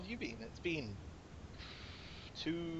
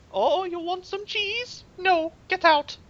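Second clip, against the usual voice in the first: slow, very high mock-mum falsetto; drawn-out falsetto caricature of mum; imitating mum; slower than usual. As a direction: play a disapproving mother in a silly high voice. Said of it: "She'll be like".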